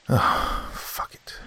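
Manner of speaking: muttered to self. A narrator's reading. Muttered decision to go.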